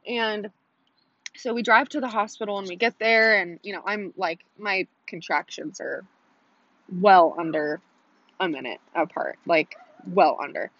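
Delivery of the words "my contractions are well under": usual speaking voice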